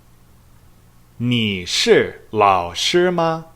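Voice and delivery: very slow Mandarin, every syllable of "你是老师吗" drawn out; slow model question. Shown as a demonstration. 老师慢慢地示范问句. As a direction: spell out "你是老师吗" slowly for learners. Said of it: "Number one".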